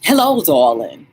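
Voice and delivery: a light, posh-ish greeting in her voice; mild impression of a friend; imitating Catherine. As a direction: greet like a gracious hostess. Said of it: "That's how she sounds".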